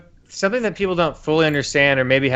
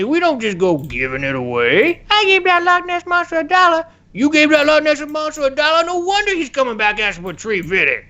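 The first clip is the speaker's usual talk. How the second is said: hammed-up storyteller dad; 12 semitones higher than usual. Chef's dad telling the Girl Scout story in a high hammed-up voice. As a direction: spin a tall tale in a big cartoon voice. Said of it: "Chef's dad would be like".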